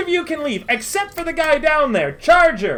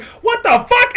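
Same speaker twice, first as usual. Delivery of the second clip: much higher, louder disbelief; startled outburst; 12 semitones higher, 5 dB louder than usual. Acted. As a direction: shriek it in shock. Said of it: "Merritt turns and is like".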